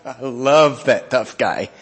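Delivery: slow, with a laugh through the words; laughing fondly. Real, not acted. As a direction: chuckle as you admire him. Chuckling admiration of Joseph.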